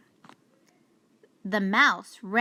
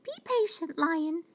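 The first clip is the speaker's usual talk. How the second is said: high, quick mouse question; mouse asking; 8 semitones higher, 3 dB quieter than usual. Acted. Mouse arriving to answer the lion's call, high and quick. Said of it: "she said".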